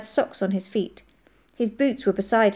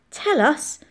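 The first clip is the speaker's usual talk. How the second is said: higher, eager plea; bursting with curiosity; 8 semitones higher than usual. A narrator's reading. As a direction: beg to hear the secret. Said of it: "clearly bursting with curiosity".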